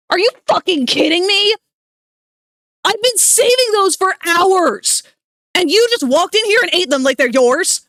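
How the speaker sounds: furious high yelling rant; angry frag voice. Shown as a demonstration. Streamer catching her friend eating her Oreos, furious yelling. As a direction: scream it out in pure unbridled rage. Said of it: "angry frag voice".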